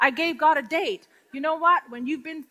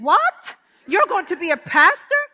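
high, loud stunned question; shocked disbelief; 4 semitones higher, 8 dB louder than usual. Retold or imitated. Woman stunned to hear he'll be a pastor. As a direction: blurt it out in astonishment. Shocked reply to his news.

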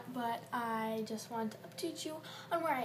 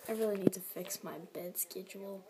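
whisper; 4 semitones lower than usual. Real, not acted. Tired aside whispered.

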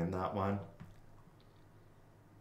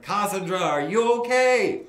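higher, louder, slow calling across a field; neighbour calling over; 10 semitones higher, 8 dB louder than usual. Retold or imitated. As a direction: call out to her across the field. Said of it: "she calls over and she's like".

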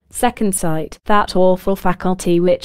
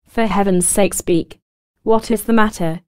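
ordinary delivery

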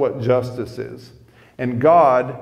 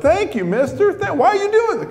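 put-on a small girl thanking shyly, pitch way up; softened little girl; 3 dB louder than usual. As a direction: daughter thanking the stranger in a high child voice. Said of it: "then she softened up".

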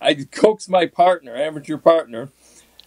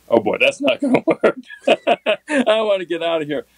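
higher voice breaking into laughter; laughing; 7 semitones higher than usual. Real, not acted. Angler recalls learning he is stranded for days, laughing. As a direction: laugh through the words at your own bad luck. Laughs retelling his bad predicament.

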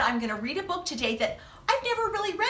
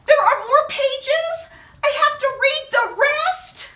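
higher, louder hammed-up dismay; mock despair; 9 semitones higher, 6 dB louder than usual. Storyteller facing more pages, hammed-up high dismay. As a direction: whine in pretend disbelief.

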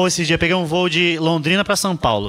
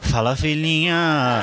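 mock soothing mother; much slower than usual. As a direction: coo it like a tired mother. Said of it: "mãe".

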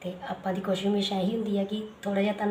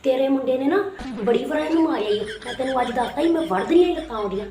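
a brash rural mother picking a fight; scolding village mother; 3 semitones higher than usual. Acted. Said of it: "ਸਾਡੀ ਪੇਂਡੂ ਮਾਂ ਵੀ".

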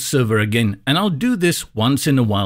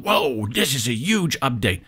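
mock awe, drawing out the whoa; tongue-in-cheek hype. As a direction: imitate overexcited online hype, tongue in cheek. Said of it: "do the tongue-in-cheek thing again".

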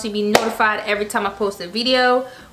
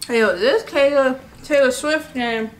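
ordinary delivery

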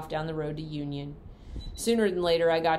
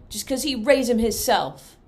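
louder, higher grumbling cousin; a jealous country boy sneering; resentful Cousin Ned; 7 semitones higher, 9 dB louder than usual. Acted. Cousin Ned griping about Simon's mules, loud.

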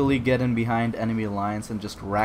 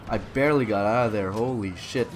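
usual speaking voice

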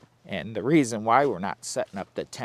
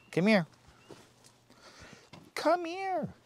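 high coaxing call; 10 semitones higher, 6 dB quieter than usual. Real, not acted. Calling dog to come.

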